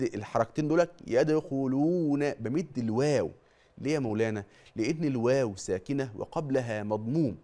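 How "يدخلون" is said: يدخلون with the waw held two counts; slow correct يدخلون; two-count madd. Shown as a demonstration. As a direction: lengthen the waw of يدخلون evenly. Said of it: "شوف المد".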